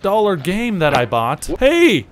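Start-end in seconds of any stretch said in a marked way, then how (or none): 1.6-2.1 sudden high, loud "Hey!"; startled yell. Real, not acted. Player yells as his character falls, loud startled yelp. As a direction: yelp it as you fall.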